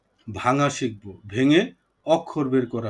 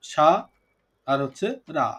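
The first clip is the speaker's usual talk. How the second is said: "সা", pause, then "রা" as separate syllables; syllable breakdown. Shown as a demonstration. Teacher breaking a student's name for the class, "সা" and "রা" said apart. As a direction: split the name into "সা" and "রা". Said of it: "আমরা সারাকে ভাঙি".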